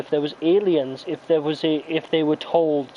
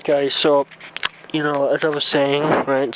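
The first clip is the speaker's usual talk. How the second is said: ordinary talk, nothing marked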